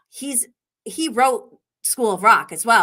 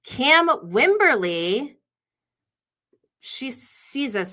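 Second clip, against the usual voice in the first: ordinary talk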